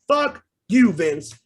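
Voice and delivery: sudden loud, high curse; angry outburst. Real, not acted. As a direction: spit it out at him in fury. Fan rant cursing at Vince.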